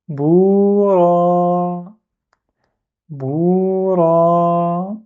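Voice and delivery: "Boura" chanted with a long "ou"; recited "Boura". Shown as a demonstration. Teacher recites the word for students. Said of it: "Si on a deux prolongements".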